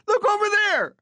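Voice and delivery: high shouted distraction; mock shouted look over there; calling out. A narrator's reading. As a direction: yell it to turn heads. Plan's name, called out.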